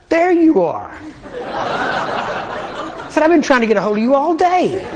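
high, laughing mock greeting; joking laughing. Greeting the cashier named Jesus, laughing and joking. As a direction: tease him through laughter. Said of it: "I was in a humorous mood".

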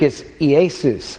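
Iesus said slowly as ee-AY-soos; Greek pronunciation. Shown as a demonstration. Showing the congregation the Greek Iesus, slow and careful. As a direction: spell out Iesus the Greek way, drawn out. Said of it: "In Greek".

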